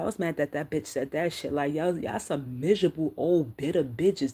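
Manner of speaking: ordinary talk, nothing marked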